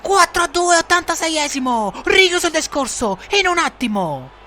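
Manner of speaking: breathless italian goal commentary. Acted. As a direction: shout the goal. Goal call shout.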